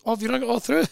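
high, light put-on voice; imitated breezy wife. Wife returning late to checkout, imitated breezy voice. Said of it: "she appears and goes".

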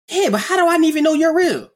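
put-on high, loud doubting young voice; younger self voiced. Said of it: "if I was talking to my Duncan self".

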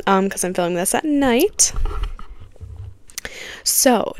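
ordinary delivery

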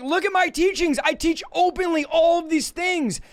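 lightly voiced Jesus, insisting; voicing Jesus. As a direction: point them to his open teaching. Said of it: "Jesus is like".

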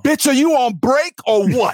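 high, sassy put-on clerk voice; mock the rude worker behind the glass. Acting rude postal worker.

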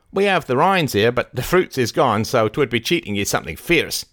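high, lively rustic keeper voice; high-pitched folksy character voice; Barnstable, eager rustic keeper. A narrator's reading. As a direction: play an eager country keeper haggling.

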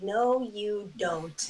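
a very slow, drawn-out East Coast Jersey "No, you don't"; Jersey accent. Retold or imitated. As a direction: stretch it out the Jersey way, dismissive. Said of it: "that really sounded like someone".

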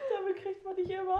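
grinning at her friend; laughing. Real, not acted. Lacht mit. (lachend neckend)

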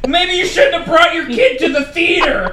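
high, loud yelled retort; retold heckle. Moviegoer to the dad with a baby, yelled scolding. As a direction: holler a mock scolding across the room. Shouts back in retold quarrel.

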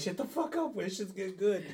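high-pitched, excited heckler voice; eager audience. Acted. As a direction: play an onlooker hushing everyone for gossip. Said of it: "Audience is like".

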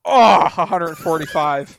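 surprised. Real, not acted. Guessed number nearly exact.

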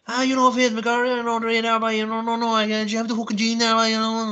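high-pitched run-on gibberish mumble; mock garbled local speech; unintelligible talker impression. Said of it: "you can't fucking talk properly".

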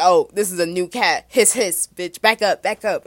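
loud hammed-up cat warning off the newcomer; mock hostile cat. The cat Yomi shouting at the new kitten, hammed-up and loud. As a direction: bark it like a territorial cat. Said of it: "she was like".

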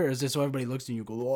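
ordinary talk, nothing marked

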